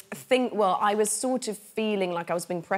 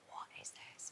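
hushed whisper, barely voiced; awed whisper; 23 dB quieter than usual. Whispering in awe on her first job. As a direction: whisper it in wonder. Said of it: "I was like".

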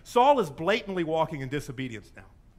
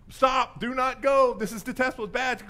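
raised, higher mock alarm; urgent warning; 5 semitones higher, 4 dB louder than usual. Warning signs to Saul, urgent warning. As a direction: call out a stop warning.

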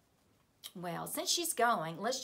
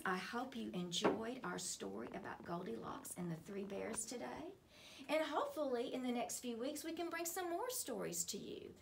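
ordinary delivery

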